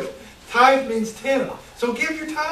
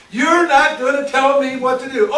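louder defiance; 5 semitones higher, 7 dB louder than usual. Acted. Repeats Cain's defiance louder.

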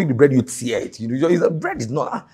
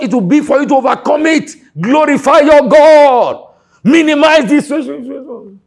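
preaching shout; 8 semitones higher, 5 dB louder than usual. Real, not acted. Preacher exhorting congregation.

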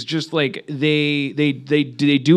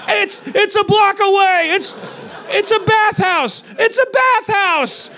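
much higher squealing yell, slowing down; panicked henchman; slower than usual. Retold or imitated. Henchman tells John Wick where to go, high panicked squeal. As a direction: squeal it out in terror. Frightened henchman gives directions.

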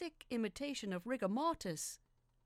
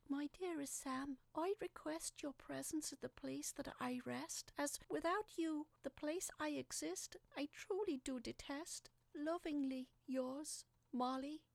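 a higher, hushed voice, half whispered, for the dead wife's letter; ghostly letter voice; 6 semitones higher than usual. Acted. Husband reads dead wife's letter aloud, hushed and eerie. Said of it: "I read it out aloud".

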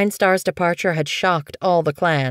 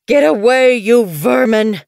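loud, higher furious yowl, the vermin drawn out; vicious yowl; 6 semitones higher, 7 dB louder than usual. A narrator's reading. Old medicine cat yowls at the kits, loud and furious. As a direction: spit it out like a mad old cat. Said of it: "A vicious yowl".

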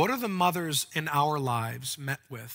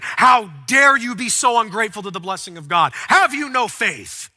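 mock rebuke; 5 semitones higher, 10 dB louder than usual. Imagined harsh rebuke by Elisha.

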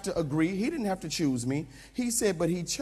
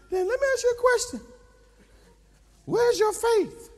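trainer's challenge; 14 semitones higher, 4 dB louder than usual. Retold or imitated. Trainer challenging the tired pastor, high and pointed. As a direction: push him like the trainer.

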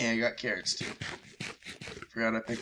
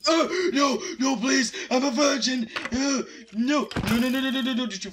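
frantic high-pitched begging, loud; scream-plead in real panic; panicked high pleading; 13 semitones higher, 8 dB louder than usual. Real, not acted. Gamer begging the mob not to kill him, panicked shriek.

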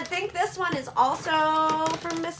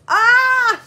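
excited squeal; 9 semitones higher, 8 dB louder than usual. Real, not acted. Squeals at cute Easter gifts.

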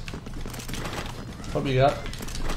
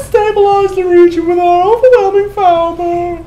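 high, loud, slow villain proclamation; high, slow hammed-up villain voice; villain announcer voice; 10 dB louder than usual. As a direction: boom it out like a campy overlord.

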